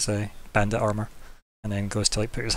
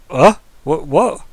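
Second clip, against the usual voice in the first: flustered; 5 semitones higher, 10 dB louder, much slower than usual. Acted. Jimmy flustered, red cheeks.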